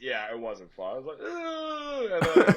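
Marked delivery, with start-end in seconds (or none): 1.2-2.1 high strained groan of pain; pained ugh. Retold or imitated. Mock pain groan retelling the spray.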